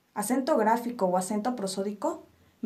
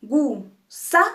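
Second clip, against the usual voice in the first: "Gu" aparte, luego "sa" fuerte; sílaba por sílaba; 6 dB louder than usual. Shown as a demonstration. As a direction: separate "Gu, sa" with the punch on "sa". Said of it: "dividimos nuestra palabra en sílabas". ("Gu, sa," en sílabas, con golpe de voz en "sa")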